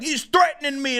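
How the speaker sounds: high-pitched imitation of a complainer; mock alarm. Streamer retelling his accuser's claim, mock alarm. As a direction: mimic an alarmed accuser, a little hammed up. Quotes accuser mockingly again.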